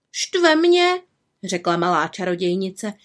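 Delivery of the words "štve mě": high, annoyed little voice; cross young witch. A narrator's reading. Little witch confiding in raven, annoyed complaint. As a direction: grumble it in a high voice. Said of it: "vykřikla zlostně".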